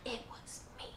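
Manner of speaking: whispered, voice dropped away; whispered confession. Retold or imitated. Traitor Kanjuro confessing to his crewmates, whispered. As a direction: breathe it out like a sly secret. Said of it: "he's the one being like".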